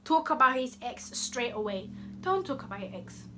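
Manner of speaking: usual speaking voice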